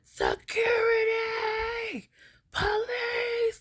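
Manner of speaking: very high, shrill-free cry for help, drawn out; yelling for help. Retold or imitated. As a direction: scream it out like you're calling across the quad. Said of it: "just started yelling".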